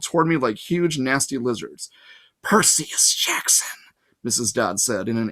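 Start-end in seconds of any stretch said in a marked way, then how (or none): 2.4-3.8 slow, whispered southern drawl on the name; a menacing southern whisper, drawn out; southern accent. A narrator's reading. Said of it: "in an accent that was definitely from somewhere farther south".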